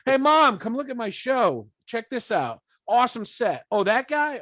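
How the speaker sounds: put-on high, loud eager kid showing off to mom. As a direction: call out to mom like an excited kid. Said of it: "How do you explain that to your mother?".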